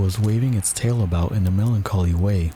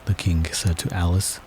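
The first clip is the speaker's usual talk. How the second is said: plain reading voice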